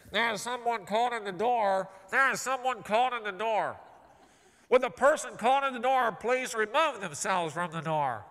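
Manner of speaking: automated loudspeaker announcement; computer voice. Acted. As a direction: recite it like a computerized train warning. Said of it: "I hear this computer voice coming over the loudspeaker".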